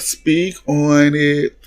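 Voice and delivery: slow, drawn-out mock hype-woman voice; egging on. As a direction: stretch it out like cheering a friend on. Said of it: "New York the whole time talking about".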